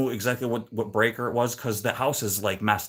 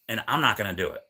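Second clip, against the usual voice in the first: usual speaking voice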